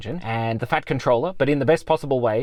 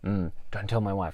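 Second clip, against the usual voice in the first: softer, low conspiratorial aside; quiet aside; 7 semitones lower, 8 dB quieter than usual. Real, not acted. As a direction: drop it like a secret. Whispered aside joke.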